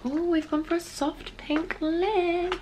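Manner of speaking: soft breathy presenter. Acted. Showing viewers her lip, slow soft presenter voice. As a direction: present it gently.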